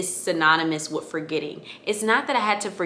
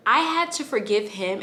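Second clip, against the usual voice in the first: ordinary talk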